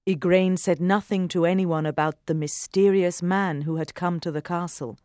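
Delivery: ordinary delivery, nothing marked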